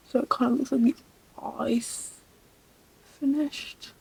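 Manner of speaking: soft whisper while concentrating. Real, not acted. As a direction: breathe the words out quietly. Whispering while doing makeup.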